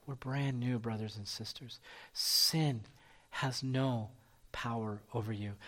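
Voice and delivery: low, soft, solemn sermon voice; hushed preaching. Real, not acted. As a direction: bring it down to a quiet, intimate assurance.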